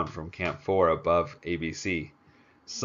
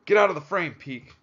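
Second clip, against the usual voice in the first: loud, much higher shouted order; shouting; 10 semitones higher, 7 dB louder than usual. Acted. Director ordering Peak out of the camera frame, loud shout. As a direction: bark him out of the shot. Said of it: "J R shouted".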